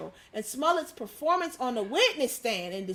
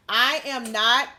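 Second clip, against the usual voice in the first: loud, slow mock outburst; lightly acted courtroom yell, drawn out; imitating a yell; 7 dB louder, slower than usual. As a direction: declare it loudly, imitating a defiant defendant. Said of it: "Smollett yelled at the courtroom".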